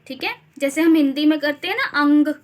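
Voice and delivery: ordinary talk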